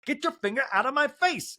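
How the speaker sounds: high-pitched mock indignation; mock indignant demand. Monica's angry demand, acted.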